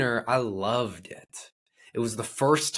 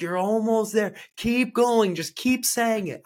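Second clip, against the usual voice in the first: high, raised-voice encouragement; urging coach; 11 semitones higher, 4 dB louder than usual. Retold or imitated. Coach cheering on a struggling client, high and urgent. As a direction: drive the client on like a trainer mid-set.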